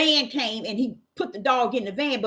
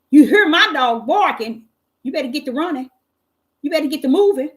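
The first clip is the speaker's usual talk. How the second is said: threatening warning; 5 semitones higher, 5 dB louder than usual. Retold or imitated. Warning the neighbor.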